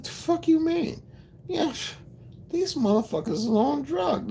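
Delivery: very high, squeezed disbelief; retold incredulous reaction. Speaker recalls what he thought of them, incredulous high voice. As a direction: pitch it way up in mock bewilderment. Retelling his bewildered inner reaction.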